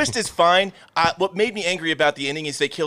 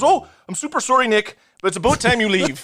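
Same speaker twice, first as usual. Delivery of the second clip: put-on lightly done Canadian accent, higher and polite; mock Canadian accent; 5 semitones higher than usual. Podcaster imitating the Canadian-sounding escort, mock Canadian accent. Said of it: "he goes".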